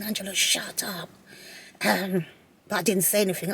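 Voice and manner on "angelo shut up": inner thought. Retold or imitated. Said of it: "in my mind thinking".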